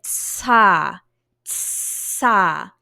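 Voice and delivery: "tsa" slowed, with a long hiss after the T; hidden S sound. Shown as a demonstration. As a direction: stretch the S inside each "tsa". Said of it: "got an S sound".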